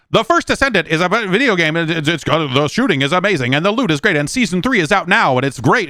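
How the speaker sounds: high, excited ad voice; hyped gamer voice. Retold or imitated. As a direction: gush like an ad. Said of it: "people going like".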